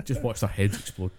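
ordinary talk, nothing marked

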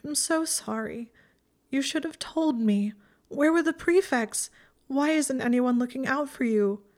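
high, distraught reproach; tearful outburst. A narrator's reading. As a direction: plead and reproach, close to tears. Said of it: "bursting into tears".

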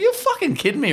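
hammed-up betrayed dad; mock outrage. Dad ignored by his daughter at school, high mock outrage. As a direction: jump very high in comic disbelief.

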